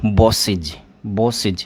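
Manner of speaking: बॉसेज with a clear iz ending; teacher modelling बॉसेज slowly; pronunciation demo. Shown as a demonstration. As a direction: sound out बॉसेज as bos-iz. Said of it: "pronunciation क्या हो जाएगा इसका?".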